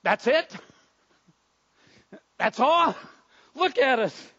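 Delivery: a touch of outraged disbelief; mild indignant disbelief. Acted. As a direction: burst out in short, dismayed bursts. Lepers' dismayed reaction to command.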